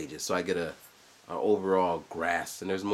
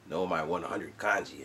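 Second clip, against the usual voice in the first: normal talking voice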